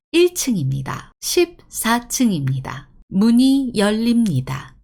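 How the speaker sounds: measured, formal public-address delivery; automated announcement. Shown as a demonstration. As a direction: recite like a recorded operator message. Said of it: "Yeah, like this".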